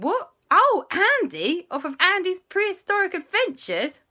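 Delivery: high, loud, gushing amazement; starstruck excitement. Acted. Relatives recognise TV's Andy, starstruck. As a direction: squeal like a thrilled fan.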